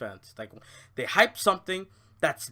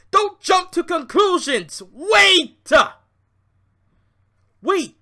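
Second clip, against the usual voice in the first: higher, louder shout at the fans; 8 semitones higher, 7 dB louder, slower than usual. Real, not acted. Telling fans to hold off, raised shout. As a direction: yell it to stop them cold.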